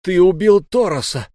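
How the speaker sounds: loud, high outcry; howled accusation. A narrator's reading. Narrator accusing the pirate of murder, howling. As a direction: howl it as you lunge at the killer. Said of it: "взвыл я".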